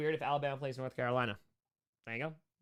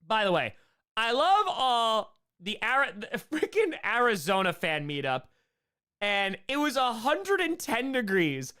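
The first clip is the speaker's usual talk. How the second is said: normal talking voice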